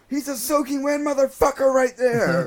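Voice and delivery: loud high excited yell; shouting on the radio. Retold or imitated. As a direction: yell it over the radio, fired up. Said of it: "And I go".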